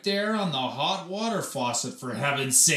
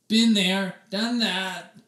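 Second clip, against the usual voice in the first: moaning; 6 semitones higher, 5 dB louder, slower than usual. A narrator's reading. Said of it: "moaned Oliver".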